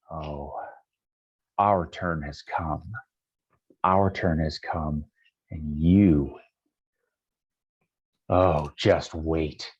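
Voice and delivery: very low, slow villainess; menacing drow lady. Acted. Drow lady tells intruders her turn has come, low slow menace. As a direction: draw the threat out slowly, deep down.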